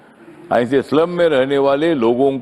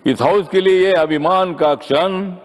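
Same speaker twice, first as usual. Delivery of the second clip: higher-pitched, declaimed couplet line; raised rhetorical repeat; 4 semitones higher than usual. Real, not acted. Member hails the new chairman's proud moment in the House, raised declamation. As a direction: lift the whole line up as a proud refrain.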